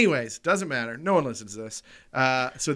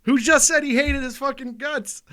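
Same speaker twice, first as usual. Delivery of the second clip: much higher and louder, almost shouting; excited disbelief; 10 semitones higher, 12 dB louder than usual. Real, not acted. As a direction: blurt it out in shocked glee. Realizing the guy is right there.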